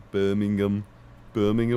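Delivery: Birmingham said the Brummy way; Brummy accent. Shown as a demonstration. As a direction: show how Brummies say Birmingham. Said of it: "How do they say it?".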